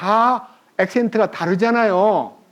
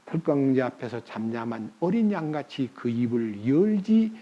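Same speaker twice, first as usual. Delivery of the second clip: hushed recitation; 7 semitones lower, 6 dB quieter than usual. Acted. Quoting Isaiah softly.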